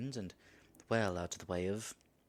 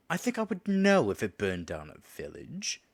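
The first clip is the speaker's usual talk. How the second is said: ordinary talk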